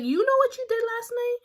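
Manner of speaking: high, loud "you know what you did last night?"; loud high accusation. Retold or imitated. Accusing the girl.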